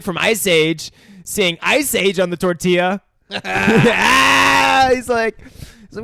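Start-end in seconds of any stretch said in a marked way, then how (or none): 3.4-4.9 long high loud mock scream; drawn-out "ahh", hammed up; imitated scream. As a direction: scream like a startled cartoon character. Imitating Sid's scream on tortilla.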